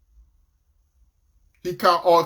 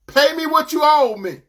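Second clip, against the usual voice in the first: loud, high-pitched demand for payment; demanding shout; 9 semitones higher, 6 dB louder than usual. Acted. Servant grabbing his debtor by the throat, demanding shout.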